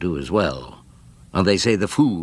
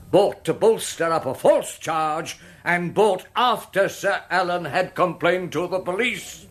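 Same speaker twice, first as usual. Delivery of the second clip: rising barrister's appeal to the jury; courtroom oratory; 7 semitones higher than usual. Acted. Barrister addressing the jury, building indignant oratory. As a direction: plead the case with mounting indignation.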